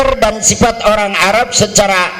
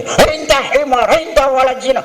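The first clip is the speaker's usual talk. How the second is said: higher, heated imitation of arguing Arabs; pitched-up mock quarrel in Arabic; 8 semitones higher than usual. Acted quarrel between Arabs.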